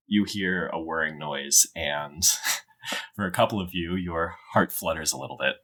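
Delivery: usual speaking voice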